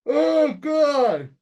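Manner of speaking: a big real yawn through the words; words stretched by a yawn. Real, not acted. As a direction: yawn it out, high and loud. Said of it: "sorry for the yawning".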